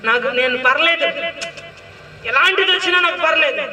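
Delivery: bold declaration. Shown as a demonstration. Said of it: "ఇంకా గట్టిగా చెప్పనా?".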